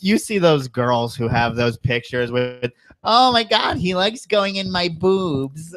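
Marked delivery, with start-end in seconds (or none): 3.0-5.8 mocking girl voice. Mocking girls' photo captions.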